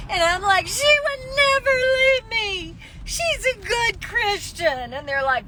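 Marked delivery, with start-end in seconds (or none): 0.7-4.9 high, crying insistence; wailing her case with a catch in the voice; tearful pleading. Retold or imitated. To the cops, insisting tearfully her mother would never leave.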